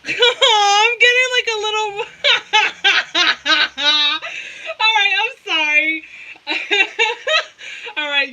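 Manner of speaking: high, loud laughter breaking through the words; real laughing fit. Real, not acted. Reader losing it mid-reading, laughing hard. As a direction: crack up and squeal out an apology.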